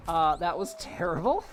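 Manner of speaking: ordinary talk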